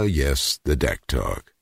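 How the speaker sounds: usual speaking voice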